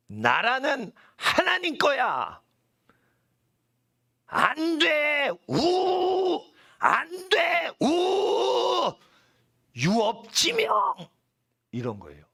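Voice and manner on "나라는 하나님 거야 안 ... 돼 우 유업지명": high, drawn-out shouted proclamation; very frightening. Acted. Preacher proclaiming that the kingdom is God's, very frightening. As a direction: cry out each word slowly as a fearsome decree. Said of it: "무지무지 무섭게 하는 거".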